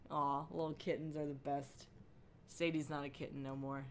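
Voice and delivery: normal talking voice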